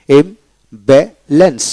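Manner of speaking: Imbalance as im, ba, lens; three separated beats in imbalance; split into syllables. Shown as a demonstration. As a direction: pause between the syllables of imbalance. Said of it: "তিনটে syllable".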